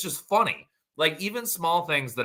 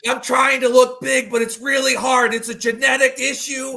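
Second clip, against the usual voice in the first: high, loud hammed-up panic; mock pleading; 13 semitones higher, 7 dB louder than usual. Co-host plays small man facing bear, mock desperate. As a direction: imitate a frantic little guy shouting up at a bear. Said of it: "You're just sitting there going".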